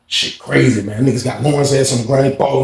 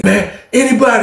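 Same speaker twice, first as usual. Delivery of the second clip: angry; 9 semitones higher than usual. Retold or imitated. Retelling angry Lawrence's announcement.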